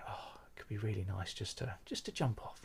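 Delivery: hushed, breathy whisper of a private thought; a near-silent confession of the urge to jump; whispered dark thought. Retold or imitated. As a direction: barely voice it, as if to yourself at night. Dark late-night thought at sea.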